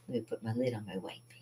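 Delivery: quick whispered aside. Real, not acted. Quick aside to herself while capping paint. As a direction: mutter it under your breath fast.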